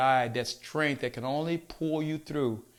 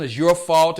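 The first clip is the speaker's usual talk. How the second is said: loud, angry accusation at the husband; angry blame; 10 dB louder than usual. Retold or imitated. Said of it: "they get angry with their husband".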